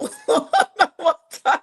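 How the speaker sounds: high, loud cackle; burst of laughter. Real, not acted. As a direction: crack up loudly. Laughing after not forcing love.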